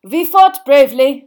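loud, slow, heavy delivery; gloomy Bulgarian minister. A narrator's reading. Bulgarian minister behind the boy after losing, gloomy. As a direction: give it as a slow, resigned verdict. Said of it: "said a gloomy voice".

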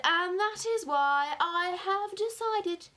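loud, high, slow build-up; ceremonious, excited declaration; grand announcement. A narrator's reading. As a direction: announce the decision with relish. Father grandly announces his plan.

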